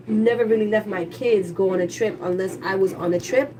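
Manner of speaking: ordinary talk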